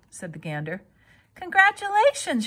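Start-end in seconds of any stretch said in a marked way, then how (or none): 1.3-2.5 high, loud call; shouting. A narrator's reading. Wilbur shouts congratulations to the goose, high and loud. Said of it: "shouted Wilbur".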